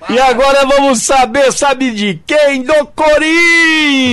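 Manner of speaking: announcer build-up. Acted. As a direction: announce it like a big reveal. Announcer-style segment intro.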